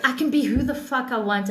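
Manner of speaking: normal talking voice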